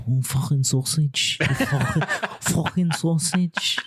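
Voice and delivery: imitating Paddy's Liverpool accent, slow, laughing through it; a hammed-up Scouse jibe, breaking into laughter; mock Liverpool accent calling someone a sausage. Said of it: "unbelievable attempt at that accent".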